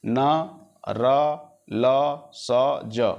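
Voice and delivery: न र ल स ज sounded one by one with the tongue at the gums; teacher sounding out alveolar consonants; alveolar sounds demo. Shown as a demonstration. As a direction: model each gum-ridge sound slowly. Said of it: "उच्चारण करके दे- देखब".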